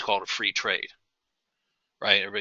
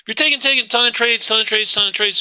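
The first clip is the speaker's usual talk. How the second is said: excited; 11 semitones higher, 4 dB louder than usual. Real, not acted. Teacher hammering a point.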